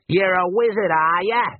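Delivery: the Emu rider taunting the wizard; bandit's taunt. Acted. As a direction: threaten him with cheerful menace.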